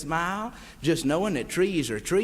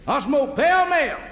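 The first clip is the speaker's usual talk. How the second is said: hammed-up high voice, bragging; Jim Hardy; 10 semitones higher, 4 dB louder than usual. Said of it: "He says".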